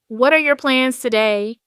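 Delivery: a hint of the husband, casually probing; light husband voice, casual question; husband asking. A narrator's reading. Husband asks before leaving.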